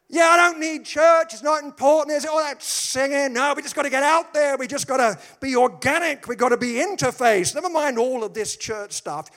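high, loud send-up of a trendy guy who doesn't need church; mock trendy church-skeptic. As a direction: ham up the know-it-all who thinks church is pointless. Mocking a church-dismissing trendy type.

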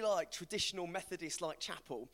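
normal talking voice

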